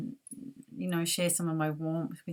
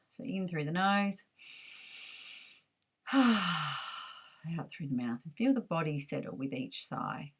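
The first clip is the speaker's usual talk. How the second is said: guided meditation; 3 dB quieter than usual. Acted.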